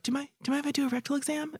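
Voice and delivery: very soft, fast, hushed ask; doctor murmurs the rectal exam request very softly; real quiet. Retold or imitated. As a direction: slip the question out almost under the breath. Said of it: "Like, real quiet".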